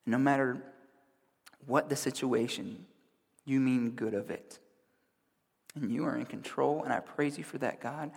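soft, hushed prayer, slipping into whispers; soft heartfelt prayer. Real, not acted. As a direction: pray quietly and humbly before God. Opening prayer before the sermon.

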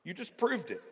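high, loud mock accusation; loud, swooping gotcha outburst; accusing Pharisees. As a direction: crow triumphantly as the outraged accusers. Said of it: "Now they're going".

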